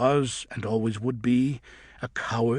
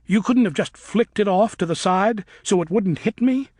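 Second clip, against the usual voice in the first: louder, higher accusing voice; young bat raising her voice at the friend who failed her; bitter accusation; 8 semitones higher, 7 dB louder than usual. A narrator's reading. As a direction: demand it of him, hurt and furious. Luna accusing Griffin.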